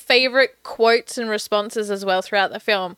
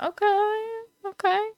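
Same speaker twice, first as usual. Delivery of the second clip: uneasy okay; 10 semitones higher, 6 dB quieter than usual. Retold or imitated. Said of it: "And I'm like".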